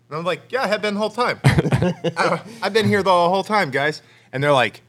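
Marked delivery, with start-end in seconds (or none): 0.5-4.0 laughing insistence. Retold or imitated.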